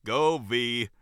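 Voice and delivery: loud, solid order with a grin; solid, grinning. Acted. Manager sending her waitress off, solidly. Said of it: "Annie stated solidly with a grin".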